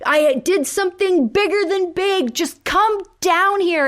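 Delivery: high, loud imitated call from below; mock yelling. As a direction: shout up to her, playing Ted. Ted calls up to Robin.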